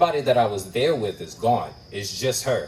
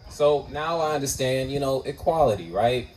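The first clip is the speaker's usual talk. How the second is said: ordinary delivery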